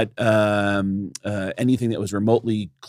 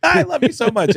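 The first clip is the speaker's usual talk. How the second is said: mock gushing; 13 semitones higher than usual. Gushing at the club owner.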